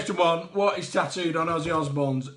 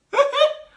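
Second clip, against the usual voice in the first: high squeal of a laugh; 10 dB louder than usual. Real, not acted. Laugh after the call-out.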